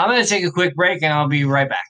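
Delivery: ordinary talk, nothing marked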